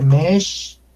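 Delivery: Mex said "mesh" with a long sh; "Mex" held on a lengthened sh; correct pronunciation. Shown as a demonstration. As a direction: stretch the sh at the end of "Mex". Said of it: "debe de pronunciarse".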